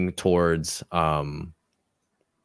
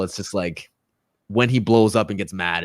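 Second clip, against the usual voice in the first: ordinary talk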